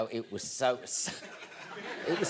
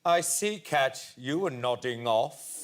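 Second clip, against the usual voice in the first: Stephen Fry impression; 4 semitones lower, slower than usual. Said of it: "And then Stephen went".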